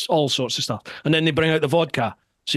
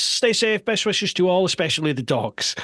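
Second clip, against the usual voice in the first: higher, bright reading voice; reading out a question; 5 semitones higher than usual. Real, not acted. Host reading a listener's question, raised pitch. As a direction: read it out to the guest.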